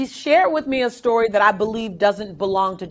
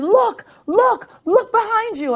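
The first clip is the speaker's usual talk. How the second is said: much higher, excited mock yell; retold scream; 9 semitones higher, slower than usual. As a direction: call out to her swimmer to look behind her. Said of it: "my coach is screaming".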